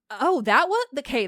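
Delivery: usual speaking voice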